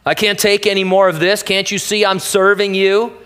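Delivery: hammed-up loud protest heavenward; imagined whining scream at God; mock anguished cry. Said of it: "He didn't scream on the spiritual side".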